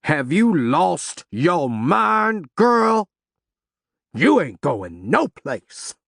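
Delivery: slow, low growling father; deep hoarse growl. A narrator's reading. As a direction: growl it like a furious preacher father. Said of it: "so hoarse and deep it sounded almost like a demonic growl".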